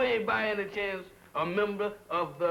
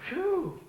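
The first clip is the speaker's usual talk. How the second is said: relieved phew; 11 semitones higher than usual. Acted. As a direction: blow out in relief. Relief after fright.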